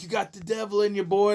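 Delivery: a hint of an accusing congregation; churchgoers scolding. Congregation scolding a laughing kid, lightly voiced accusation. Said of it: "They were like".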